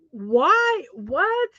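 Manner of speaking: high, rising, puzzled questions; baffled disbelief. Retold or imitated. Retelling own reaction to an odd holiday, baffled. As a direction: act out a baffled double take.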